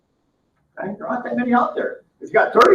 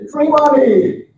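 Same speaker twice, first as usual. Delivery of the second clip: mocking punchline; 5 semitones higher, 5 dB louder, much slower than usual. What does he want?.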